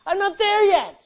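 high, loud pulpit holler; full-voiced, high-pitched cry; shouted admission. Real, not acted. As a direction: shout the confession out.

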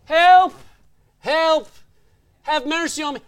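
high mock plea, loud then easing; crying out. Healed man foolishly begging on the sideline, mock crying out. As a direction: imitate a beggar calling for help.

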